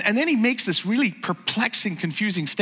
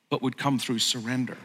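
ordinary talk, nothing marked

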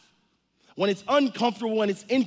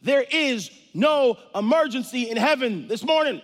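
usual speaking voice

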